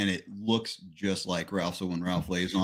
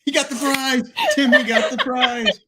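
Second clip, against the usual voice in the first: high laughing voice; 8 dB louder than usual. Acted. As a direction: squeal it through laughter. Laughing mock announcement.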